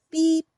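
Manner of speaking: high, bright little horn beep; truck beep. A narrator's reading. Little truck greeting a toad, bright beep. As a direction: toot like a friendly horn. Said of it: "said Blue".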